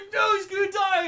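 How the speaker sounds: high, loud protest; upset shout. Acted. Guy reacting to his friend's danger, upset shout. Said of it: "gets pissed off".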